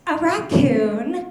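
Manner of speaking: lightly voiced little kid, high and drawn out; three-year-old. Three-year-old answering what she wants to be, mock childlike, drawn out. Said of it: "The three-year-old looks at me".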